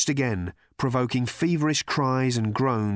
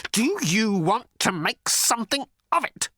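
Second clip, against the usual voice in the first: high, halting, quavering dare; quavering challenge; 11 semitones higher than usual. A narrator's reading. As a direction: dare him, voice wobbling up high. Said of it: "could not keep the quavering out of his voice".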